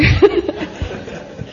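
a breathless high titter; high voiceless giggle. Real, not acted. Laughing at own confession.

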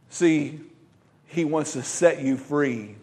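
normal talking voice

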